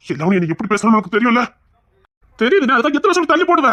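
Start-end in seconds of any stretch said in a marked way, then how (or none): 2.4-3.7 higher and louder, pleading hard; exasperated outburst. Acted. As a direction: demand an answer, frustrated. Arguing with elder brother.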